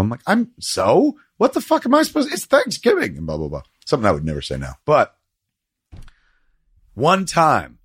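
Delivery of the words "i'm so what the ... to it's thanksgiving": pitched way up, mocking his old defiant self; a hammed-up whiny protest; mock indignant. Mocking his younger self's Thanksgiving defense, high whine.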